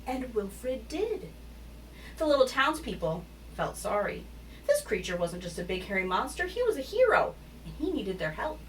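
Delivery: animated picture-book read, lively pitch swings; story narration. A narrator's reading. Narrator telling children of Wilfred the hairy giant, animated storytelling. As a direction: read it expressively to young children.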